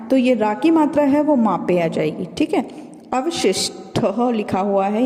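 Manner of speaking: ordinary delivery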